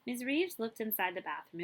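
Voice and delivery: ordinary delivery, nothing marked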